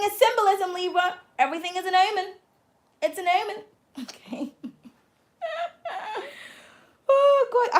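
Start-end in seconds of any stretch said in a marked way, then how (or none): 4.0-4.6 rueful laugh. Real, not acted. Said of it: "I have to laugh". 7.1-7.8 exasperated. Real, not acted. Exasperated cry after laughing.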